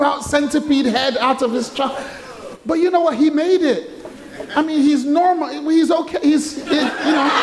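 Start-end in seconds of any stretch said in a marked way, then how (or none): 2.7-7.3 high-pitched, laughter creeping in; amused, breaking into laughter. Real, not acted. Preacher reassuring congregation about his brother, starting to laugh. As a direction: try to get through it while starting to laugh.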